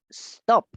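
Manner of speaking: teacher models stop without an added E; no leading E. Shown as a demonstration. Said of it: "empiezo con la S".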